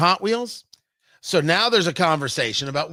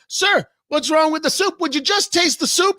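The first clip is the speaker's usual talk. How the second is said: exasperated; 8 semitones higher than usual. Acted. Waiter pleading, pitch up.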